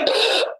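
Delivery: sharp mock gasp of horror; shocked gasp. Retold shocked reaction on air.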